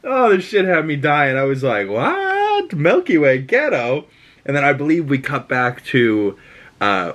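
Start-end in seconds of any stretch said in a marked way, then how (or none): 1.9-4.1 replaying a stunned, high-pitched "what"; retold disbelief. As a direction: squeal the first word, then drop into bafflement. Retells own shocked reaction.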